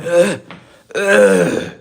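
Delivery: loud gagging ughs; retching groan. Retold or imitated. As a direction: gag twice, loudly. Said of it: "I'm like".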